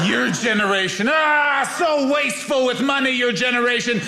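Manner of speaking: high-pitched, exasperated elder imitation; griping older relative. Older family member scolding a millennial's wasteful spending, high exasperated griping. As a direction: groan and scold like a disgusted old relative. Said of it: "various older members of my family walking up going".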